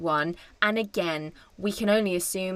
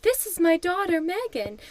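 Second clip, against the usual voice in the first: mock proud Kim; 9 semitones higher than usual. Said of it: "she's like".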